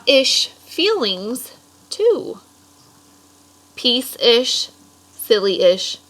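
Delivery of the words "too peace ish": plain reading voice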